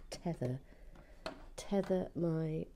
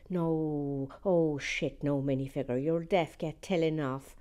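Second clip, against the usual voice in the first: put-on viewer's comment read in a light, louder Irish accent; 6 dB louder than usual. Reading Noreen's chat comment aloud, light Irish accent. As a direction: play Noreen from Cork with a lilting Irish accent. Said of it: "enjoying that accent for Noreen".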